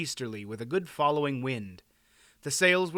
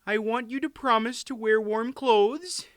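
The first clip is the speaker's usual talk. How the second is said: high-pitched fretful wife; high, worried queen voice; fussing queen; 9 semitones higher than usual. A narrator's reading. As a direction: fuss over him in a high voice. Tearful queen fussing over husband.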